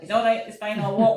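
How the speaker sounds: high, loud, flustered insistence that she'll walk; flustered protest. Retold or imitated. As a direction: squeal it out like you're mortified to be a fuss.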